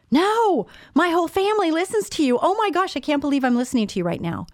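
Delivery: squealing high, thrilled fan voice; excited fan. Retold or imitated. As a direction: gush like a starstruck listener who just recognized the host. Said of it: "and she goes".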